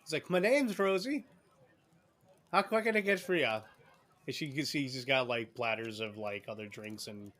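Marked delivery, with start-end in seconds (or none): 0.3-3.6 lightly voiced rambunctious barmaid, pitched up; a touch of shrill tavern hostess; Rosie. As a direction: greet customers in a higher, bustling voice. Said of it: "this kind of rambunctious".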